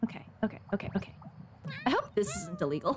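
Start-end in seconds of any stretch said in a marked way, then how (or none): 0.0-1.1 hushed, breathy repeated okays; quiet nervous okays. Real, not acted. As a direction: mutter them to calm yourself. Nervously climbing onto object.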